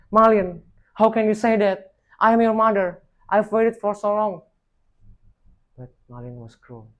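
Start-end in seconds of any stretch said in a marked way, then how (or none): 0.1-4.4 high, loud heartbroken pleading; anguished cry. A narrator's reading. Mother pleading with her cruel son, loud anguished cry. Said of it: "She cried".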